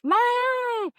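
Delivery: high, loud, drawn-out cry of alarm; hammed-up high-pitched yelp; mock panicked wail. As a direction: imitate a patient wailing over an injury. Retelling patient crying out in panic.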